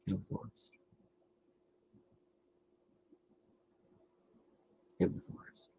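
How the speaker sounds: drifting, barely voiced repeats; fading whisper. Real, not acted. Worn out after the airport, fading to a whisper. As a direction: let it trail off into a whisper.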